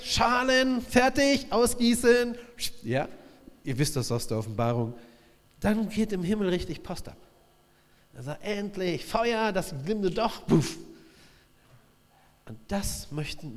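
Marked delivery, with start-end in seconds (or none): 0.0-2.3 loud, high commanding call to the angels; God shouting orders, higher and louder; rallying shout. Retold or imitated. God rallying angels to pour bowls. 8.4-10.8 higher, slow, building to a burst on puff; joyful, rising; eager exclaim. Retold or imitated. God rejoicing, fire kindled.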